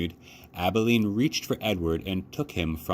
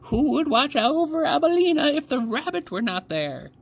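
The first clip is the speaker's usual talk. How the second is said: put-on eerie old woman; 3 dB louder than usual. A narrator's reading. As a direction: ask it like a hawk watching a mouse. Grandmother stares at rabbit.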